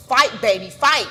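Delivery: loud rallying cry from a mother; mother's urging. Retold or imitated. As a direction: urge your child to keep fighting. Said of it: "I'm hearing my mom's voice".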